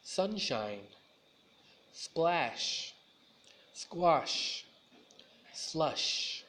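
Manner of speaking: "Sunshine" with a hissed "s" then a hushing "sh"; s and sh contrasted. Shown as a demonstration. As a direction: set the thin "s" against the round "sh" in each word. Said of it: "Now you try it with me".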